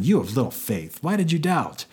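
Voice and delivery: mock scolding, pitch jumping high on the question. Jesus to Peter after catching him, scolding. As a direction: scold him with an exaggerated rising reproach. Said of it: "what it sounds like here is that he scolded him".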